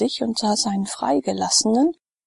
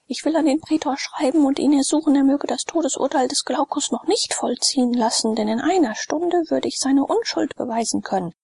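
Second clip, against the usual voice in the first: higher, hurried orders to the slaves; urgent commands; 7 semitones higher than usual. Acted. Sallust calling to Davus for clothes and papyrus, urgent and hurried. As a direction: bark out orders in a rush.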